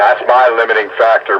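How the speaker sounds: ordinary talk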